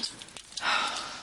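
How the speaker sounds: soft breathy sigh; heavy sigh. Acted. Mother greets returning daughter, soft sigh. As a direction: let out a weary breath. Mother before an awkward talk.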